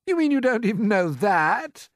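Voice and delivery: high, loud, incredulous centipede; jeering centipede cry. A narrator's reading. Centipede mocking James's ignorance, crying out. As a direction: cry it out in disbelief. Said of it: "cried the centipede".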